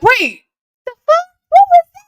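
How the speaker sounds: high, loud startled yelp; nervous startle. Retold or imitated. Retelling hearing pops outside, startled high yelp. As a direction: jump at the sound, alarmed. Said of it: "So I got nervous".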